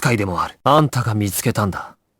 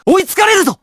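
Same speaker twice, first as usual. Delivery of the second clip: much higher, louder warning yell; urgent shout; 4 dB louder, faster than usual. Acted. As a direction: scream the warning. Warning while being chased. (切迫した叫び)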